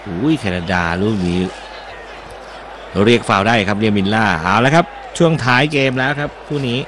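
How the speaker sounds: brisk live match call, following the ball; play-by-play commentary. Acted. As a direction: call the action as it happens.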